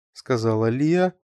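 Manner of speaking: ordinary delivery, nothing marked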